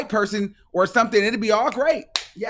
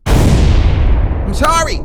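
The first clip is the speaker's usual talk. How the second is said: slow, loud breathy mock sorry; mock apology, drawn out; sarcastic sorry; 7 semitones higher, 10 dB louder, much slower than usual. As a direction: stretch the fake apology out.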